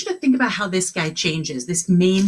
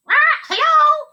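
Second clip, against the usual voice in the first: much higher, loud squawking parrot; 7 dB louder than usual. Acted. Pirate's parrot squawks hello at the kids. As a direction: screech it like a parrot.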